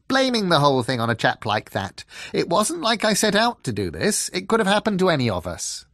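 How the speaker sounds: high, loud, hurried excuse; defensive fox. A narrator's reading.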